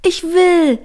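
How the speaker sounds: high, loud, delighted shout; grinning, eager. A narrator's reading. Line agrees to go skating, eager high exclamation. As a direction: burst out with a big grin. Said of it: "Line grinste breit".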